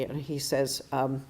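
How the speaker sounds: usual speaking voice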